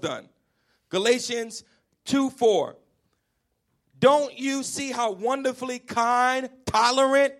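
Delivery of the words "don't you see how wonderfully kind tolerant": slow scripture read. Real, not acted. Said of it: "somebody say tolerant".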